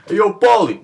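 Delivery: high, loud mumbling Philly tough guy; awful Rocky impression. Fan doing a bad Rocky impression for viewers, hammed-up. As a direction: ham up a bad Rocky Balboa. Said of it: "That's an awful impression of Rocky".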